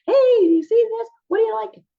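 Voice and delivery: bright high eager voice; engaging, excited. Shown as a demonstration. Teacher showing an attention-giving voice, high and eager. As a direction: sound eager to grab attention. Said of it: "I'm engaging".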